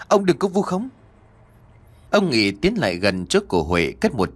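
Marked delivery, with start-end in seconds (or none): 0.1-0.9 even higher, ông rising in alarm; panicked plea. Acted. Daughter pleading with the official, desperate. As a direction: repeat the protest more desperately. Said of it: "Huệ hốt hoảng lắm".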